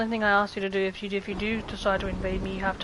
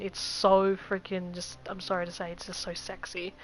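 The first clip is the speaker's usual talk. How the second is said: usual speaking voice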